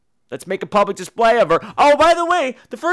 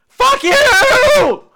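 real angry yell, much higher and louder; furious shout; 7 dB louder than usual. Real, not acted. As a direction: blast it at the filmmakers. Ranting at the filmmakers.

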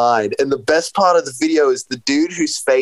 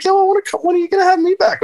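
much higher, fast, eager mock voice; imitating himself; much faster than usual. Guest retells begging to come back on, in a high mock voice. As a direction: pitch it way up like a pleading fanboy. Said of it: "I was like".

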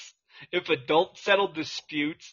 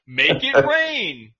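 loud, slow, higher hype call; 8 semitones higher, 8 dB louder, slower than usual. Pretend. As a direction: drag it out like a club hype man. Hype call in joke list.

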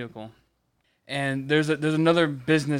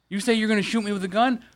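heated challenge; 7 semitones higher, 3 dB louder than usual. Real, not acted. To his cohost about the gunman, a rising indignant challenge. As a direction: voice climbing high and loud in real outrage.

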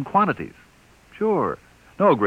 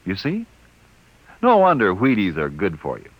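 upbeat radio commercial pitch; ad read; 6 semitones lower than usual. Acted. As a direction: sell the cereal brightly.

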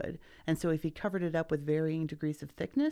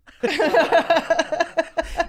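long, loud, high laugh; 11 semitones higher, 11 dB louder than usual. Real, not acted. Hearing someone ask who Pasteur is, loud laugh. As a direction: burst out laughing at the question.

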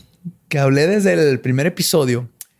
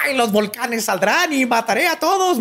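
high hammy villain voice; mock a wannabe evil man, pitched up; 6 semitones higher than usual. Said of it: "tratando de verse malo". (villano ridículo, voz aguda)

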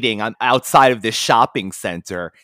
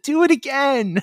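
high-pitched imitation of an excited child; mock childlike excitement, way up high; excited kid; 13 semitones higher than usual. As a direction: squeal it like a thrilled little boy. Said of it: "because he was excited".

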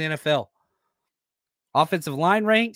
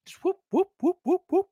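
whooping sound effect; 13 semitones higher than usual. Retold or imitated. Mimics defender rushing past.

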